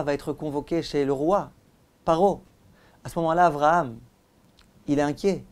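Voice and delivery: ordinary talk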